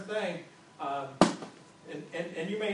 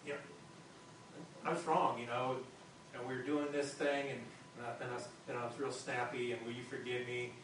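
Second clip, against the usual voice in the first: sincere apology; 5 semitones lower, 5 dB quieter than usual. Pretend. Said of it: "what I do is go".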